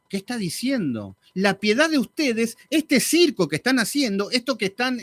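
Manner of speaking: usual speaking voice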